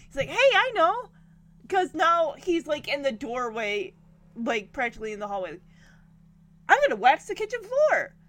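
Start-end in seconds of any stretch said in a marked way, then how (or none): 0.3-1.1 higher and louder, a hammed-up bright idea; imitating an eager dad; mock excited idea. Danny gets an excited idea. 6.7-8.1 higher, louder and quick; hammed-up dad proudly declaring his chore; eager sitcom dad; mock eager announcement.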